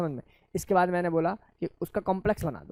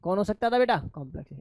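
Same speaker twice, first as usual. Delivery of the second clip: normal talking voice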